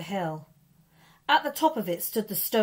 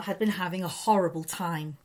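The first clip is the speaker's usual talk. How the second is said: plain reading voice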